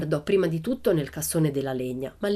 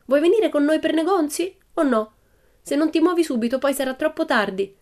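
put-on high, louder girl's voice calling out; Pippi scolding her hat; 10 semitones higher, 5 dB louder than usual. A narrator's reading. Pippi calling her hat to come shopping, high and loud. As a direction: call to a naughty hat.

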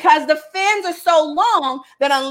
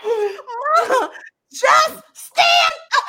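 laughing half-shout, much higher, stretched slowly; retold screaming; 10 semitones higher, much slower than usual. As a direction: call out to mom between laughs. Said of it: "I just start screaming".

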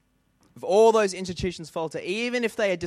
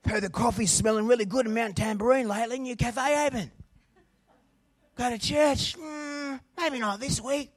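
higher, hammed-up ocker bloke, laughing and calling out; laid-back Aussie excuses; 6 semitones higher than usual. Preacher mocks churchgoers who pick beach over church, hammed-up laid-back Aussie. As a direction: play a carefree Aussie dodging church with a grin.

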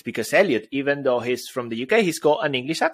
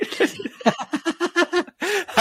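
real burst of high laughter. Real, not acted. Laughing at partner's joke.

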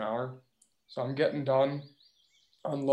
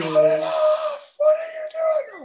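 shrill screamed yell, far higher and louder; screaming farmer; 9 dB louder, slower than usual. Retold or imitated. Angry farmer screaming at the driver, mock scream. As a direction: scream it like a furious farmer, slow and stretched. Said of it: "he's screaming at me".

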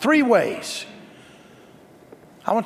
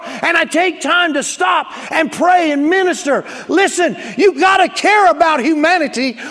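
raised, loud preacher's shout; full-force preaching; 5 semitones higher, 3 dB louder than usual. Acted. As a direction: build the sermon to a fiery climax.

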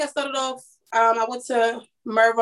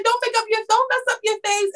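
much higher squeaky retold plea; tearful teasing plea; 13 semitones higher than usual. Makeup artist to crying client, retold in a high teasing plea. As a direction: re-enact soothing her through tears, pitched way up.

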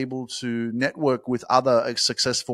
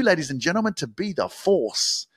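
ordinary talk

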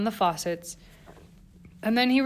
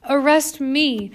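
slow, loud, higher pleading cry; begging shout; 6 semitones higher, 6 dB louder, slower than usual. A narrator's reading. Eleanor begging the police officer, loud pleading cry. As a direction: throw yourself on the officer's mercy.

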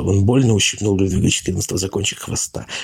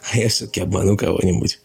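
ordinary delivery, nothing marked

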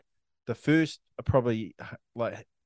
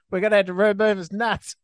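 mock panicking doctor; 9 semitones higher, 5 dB louder than usual. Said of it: "doctors are running around panicking, going".

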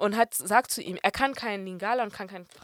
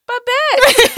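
high, loud shout of a name; imitated call-out; 15 dB louder than usual. Performer summoning a translator from the stage, loud high call.

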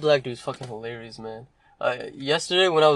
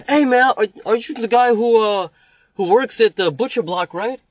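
high, loud puzzled customer question; customer's voice; 7 semitones higher, 4 dB louder than usual. Retold or imitated. Confused customer asking if he works there, high and loud.

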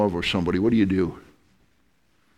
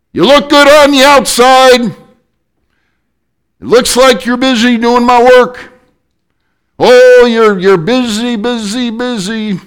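high, loud rebuke, repeating busy; voice of Jesus; 14 semitones higher, 11 dB louder than usual. Acted. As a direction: call them out from on high. Jesus speaking to the church.

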